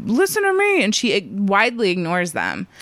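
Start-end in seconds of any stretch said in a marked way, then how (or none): 0.1-0.8 hammed-up needy cry; mock pick-me plea. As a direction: imitate begging to be heard. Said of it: "pick me people".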